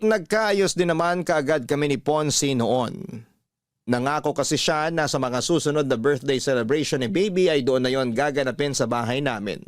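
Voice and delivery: ordinary talk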